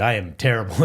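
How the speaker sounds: normal talking voice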